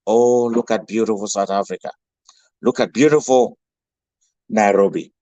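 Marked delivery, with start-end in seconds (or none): none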